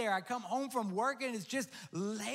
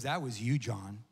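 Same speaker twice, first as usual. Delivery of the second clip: lower, tender voice of God; voice of the Lord; 6 semitones lower than usual. Acted. The Lord speaking to pastor John through the dog, low and tender. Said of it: "he goes".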